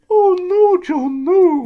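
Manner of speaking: very high, loud wailing Scottish plea; Scottish producer's anguish. Retold or imitated. Imitating his producer at the Trump interview, high wailing plea. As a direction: wail it like a horrified Scottish woman. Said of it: "Judith went".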